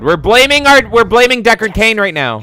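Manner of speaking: high and loud; excited. Real, not acted. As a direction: blurt it out in excitement. Excited reaction to game event.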